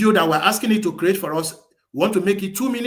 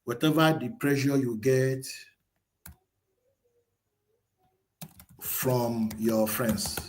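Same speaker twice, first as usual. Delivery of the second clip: slow, deliberate dictating voice; slow dictation; 3 semitones lower, 4 dB quieter, slower than usual. Acted. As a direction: spell the prompt out word by word. Dictating a prompt to the AI.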